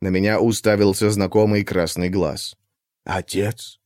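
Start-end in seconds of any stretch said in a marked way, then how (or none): 3.1-3.8 creature voice. Acted. Little creature answers.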